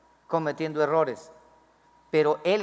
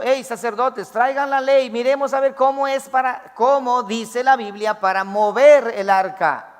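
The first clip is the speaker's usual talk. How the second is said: high, loud summons; imitating David calling out loud to the priests; 6 semitones higher, 6 dB louder than usual. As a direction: call out to the priests for the law. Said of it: "Si él hubiese dicho".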